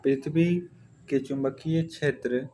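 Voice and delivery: usual speaking voice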